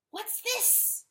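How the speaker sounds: a sharp high hissed "What's this?". A narrator's reading. Rooster hissing at the weeping dog. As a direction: spit it out in disgust. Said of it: "the rooster hissed".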